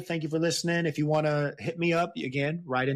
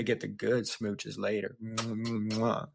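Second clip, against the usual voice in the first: smooching tease; 4 semitones lower, 4 dB quieter, slower than usual. Acted. Teasing partner with smooches.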